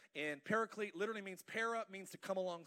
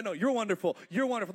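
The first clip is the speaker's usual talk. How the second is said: outdo the compliment, louder; building praise; 2 semitones higher, 10 dB louder, faster than usual. Pretend. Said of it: "the Son would say".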